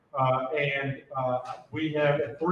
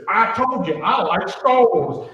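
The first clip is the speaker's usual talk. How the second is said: louder, slow and insistent; imitating a proud girl, much louder; proud declaration; 6 semitones higher, 11 dB louder, slower than usual. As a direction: announce it proudly to prove the point. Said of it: "And she goes".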